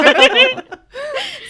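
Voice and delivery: high laughing fit; loud burst of laughter. Real, not acted. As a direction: laugh hard at yourself. Laughing after own quote.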